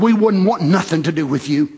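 ordinary talk, nothing marked

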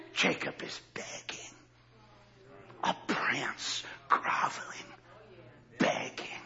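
whispered, slow and breathy; hushed whisper. Real, not acted. Preacher hushing the church over Jacob begging, whispered.

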